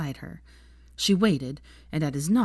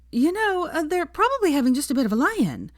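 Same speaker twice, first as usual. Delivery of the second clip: much higher, airy and dismissive; 11 semitones higher, 4 dB louder than usual. A narrator's reading. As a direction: brush it off lightly. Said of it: "said a new voice dismissively".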